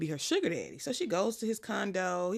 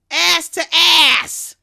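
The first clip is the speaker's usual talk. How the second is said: slow, drawn-out shout, much higher and far louder; yelling; 10 semitones higher, 17 dB louder, much slower than usual. Retold or imitated. Men in a movie scene yelling, slow loud chant. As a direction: bellow it like a rowdy crowd.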